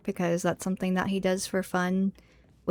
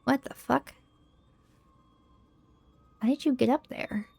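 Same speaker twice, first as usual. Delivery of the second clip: surprised; 5 semitones higher than usual. Real, not acted. Startled by enemy on ceiling.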